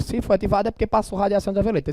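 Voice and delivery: normal talking voice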